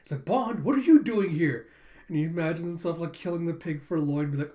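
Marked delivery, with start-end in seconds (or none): 0.2-1.7 higher, loud mock astonishment; startled, raised-voice greeting; surprised exclamation. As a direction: call out in surprise at the dog. Lloyd surprised to see dog.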